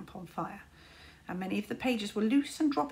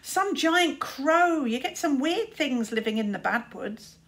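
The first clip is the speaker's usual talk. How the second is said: drawn-out, louder mockery; mocking; 5 semitones higher, 5 dB louder than usual. A narrator's reading. Luther mocking his little brother, loud and slow. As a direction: stretch giant crow out to mock him.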